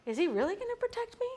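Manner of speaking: "protect me" lifts high like a question mark; doubtful question. Shown as a demonstration. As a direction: ask it unsure, pitch climbing to the end. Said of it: "is there a question mark".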